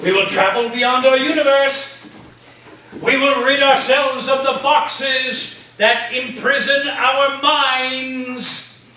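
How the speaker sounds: rally the class beyond the universe; grand proclamation. Acted. Professor proclaiming the voyage to his students, rousing and grand.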